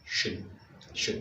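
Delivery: sion said as shun; English ending. Shown as a demonstration. Teacher isolating the English suffix for students. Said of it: "So, it's".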